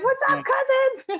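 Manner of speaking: high, loud, cheerful call to the locals; beaming hello to new cousins; excited greeting. Retold or imitated. Retelling how they greeted the locals, a high bright call. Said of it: "And we're like".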